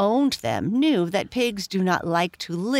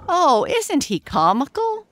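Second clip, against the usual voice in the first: high, louder delighted exclamation; delighted cry; 10 semitones higher, 5 dB louder than usual. Acted. Farmer's wife looks into the pen and delights in the pig. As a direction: gush over the funny little pig. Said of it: "she cried".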